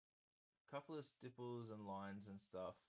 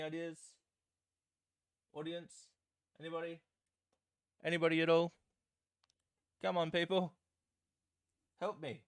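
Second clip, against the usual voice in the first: loud pleading to the chat; calling out for help; 6 semitones higher, 12 dB louder than usual. Real, not acted. Begging audience for help.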